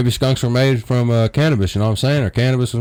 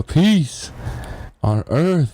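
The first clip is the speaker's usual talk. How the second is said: lightly voiced fighter, stretched out; mock the fighter's slow, drawn-out words; imitating the MMA fighter; much slower than usual. Mocking the MMA fighter's quote to his friend, lightly voiced. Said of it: "he was like".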